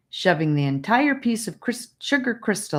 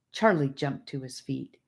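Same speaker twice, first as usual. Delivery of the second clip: plain reading voice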